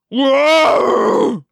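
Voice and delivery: long, loud howl of pain; alien yell. A narrator's reading. Hork-Bajir struck in the eyes by a hawk, yelling. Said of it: "the Hork-Bajir yelled".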